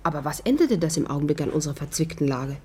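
usual speaking voice

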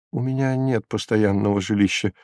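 soft, bashful admission; shy answer. A narrator's reading. Homeless prisoner answering the procurator, shyly. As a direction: say it gently, a little embarrassed. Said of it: "застенчиво ответил арестант".

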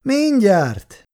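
high, smug reassurance; condescending. Acted. Kátai calms the angry woman, smugly condescending. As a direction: soothe her from above. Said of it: "nyugtatta a férfi fölényesen".

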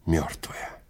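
ordinary delivery

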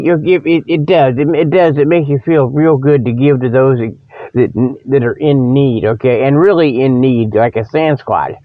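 usual speaking voice

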